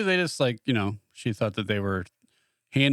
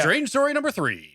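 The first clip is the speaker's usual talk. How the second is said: high, slow show-host announcement; lightly hammed-up host intro; mock announcer; 11 semitones higher, slower than usual. Announcing the next segment.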